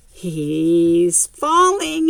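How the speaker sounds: slow, stretched-out worried warning; drawn-out worry. Real, not acted. Storyteller watching the boy topple, slow and anxious. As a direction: draw it out as he starts to tip. Building suspense over a fall.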